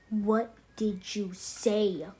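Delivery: drawn-out, slow threat; slow menace. Acted. Kid slowly demanding what the other said, menacing. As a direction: stretch every word like a threat.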